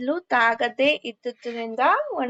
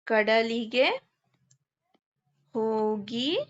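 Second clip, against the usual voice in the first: slow, word by word, softer dictation; 5 dB quieter, slower than usual. Acted. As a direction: dictate each word for the class to write. Dictating words for writing.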